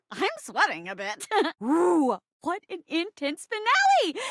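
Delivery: ordinary talk, nothing marked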